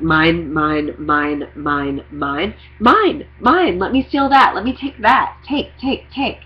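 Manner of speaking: rising greedy chant of mine; greedy grabbing voice. Retold or imitated. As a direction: grab everything like a greedy child. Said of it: "that false world where it's like".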